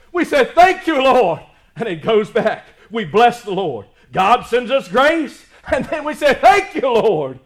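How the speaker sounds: loud rhythmic preaching, punching each thank you; soaring sermon delivery; full-force preaching. Acted. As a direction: preach it out to the congregation. Preaching the grace rebound image.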